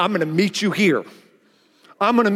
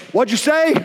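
confrontational; 5 semitones higher than usual. Retold or imitated. Pastor marching up to the stranger, ready to fight. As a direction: challenge him, chest puffed out.

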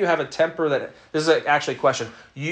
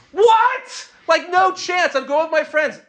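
put-on teen protest; 12 semitones higher than usual.

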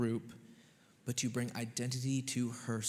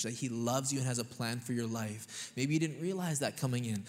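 ordinary delivery

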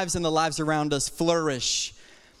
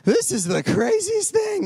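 higher, astonished exclaim; mock disbelief; 11 semitones higher than usual. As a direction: sound stunned. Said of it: "I'm just like".

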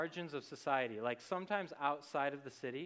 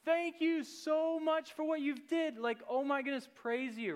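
high, loud, overjoyed thanks; a healed leper loudly thanking Jesus, high and overjoyed; loud grateful cry; 12 semitones higher, 5 dB louder than usual. Retold or imitated. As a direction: cry out thanks to Jesus, much higher and louder. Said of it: "it says he's coming in a loud voice".

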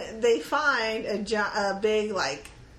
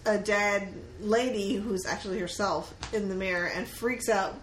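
normal talking voice

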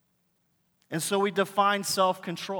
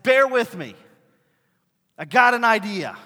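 eager plea; 4 semitones higher, 9 dB louder than usual. Retold or imitated. Pastor pleads with wife over whiteboard.

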